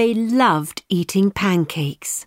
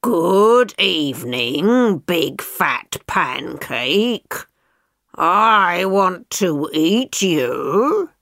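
slow, grunty pig voice; slower than usual. Acted. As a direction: drag it out like a pig. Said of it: "the pancake met a pig".

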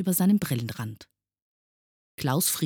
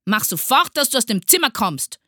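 loud, high angry order; furious yell; 10 semitones higher, 8 dB louder than usual. A narrator's reading. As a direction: yell the pupil out. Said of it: "schrie Herr Groll noch lauter". (wütend hinausjagend)

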